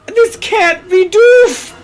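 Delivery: despairing wail. Acted. Mia in despair, transforming.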